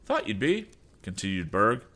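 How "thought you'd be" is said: high, gloating rival voice; smug rival. Acted. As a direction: toss it off smugly. Berg's high taunting voice.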